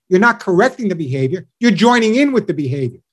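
usual speaking voice

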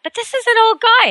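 sharply high exasperated outburst; incredulous. Real, not acted. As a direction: jump way up in disbelief. Exasperated rant.

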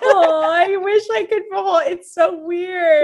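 very high, drawn-out tearful cooing. Real, not acted. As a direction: squeal through tears to the dog. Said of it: "she's crying".

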